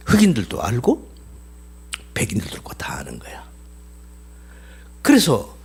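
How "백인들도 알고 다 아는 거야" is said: dramatic whisper. Real, not acted. Preacher stresses shared knowledge.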